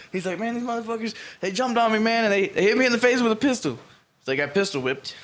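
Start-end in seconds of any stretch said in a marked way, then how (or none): 0.4-3.7 high, agitated victim's complaint; imitated freaking out. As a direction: pour it out like a frantic victim. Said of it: "crying and fucking freaking the fuck out".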